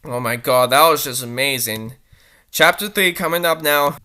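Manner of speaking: ordinary talk, nothing marked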